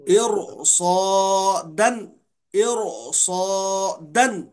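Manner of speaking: इरसादन very slow, each sound full, heavy ra; slow model reading. Shown as a demonstration. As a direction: show the student इरसादन calmly. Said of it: "सुकून से पढ़िए आप". (शिक्षक का धीमा उच्चारण)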